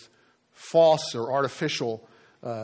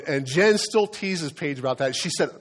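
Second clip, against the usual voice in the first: ordinary talk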